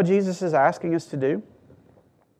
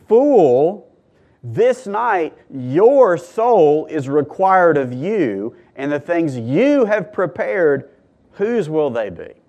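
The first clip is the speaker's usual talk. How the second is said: God's rebuke; 4 semitones higher, 5 dB louder, slower than usual. Acted. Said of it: "But God said to him".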